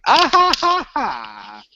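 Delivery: high, slow, hammed-up laughter; mock high fake laugh. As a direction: fake the cast's cheesy laugh. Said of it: "they're all like".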